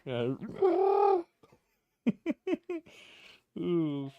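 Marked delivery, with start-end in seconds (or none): 0.6-1.2 high, loud yell broken by laughter; mock Hulk rage yell. Imitating the shampoo prank victim losing it, laughing yell. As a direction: laugh while acting out the guy freaking out. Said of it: "raging like the Hulk".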